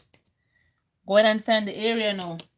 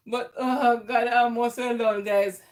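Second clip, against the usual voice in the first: ordinary talk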